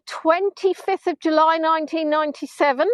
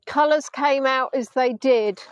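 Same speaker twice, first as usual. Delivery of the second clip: ordinary talk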